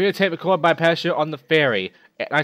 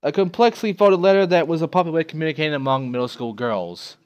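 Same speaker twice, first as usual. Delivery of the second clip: normal talking voice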